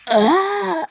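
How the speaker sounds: high loud scream of pain. Acted. Deku pinned to the wall screams loud and high in pain. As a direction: cry out as the blade goes in. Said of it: "He's crying, and he's like".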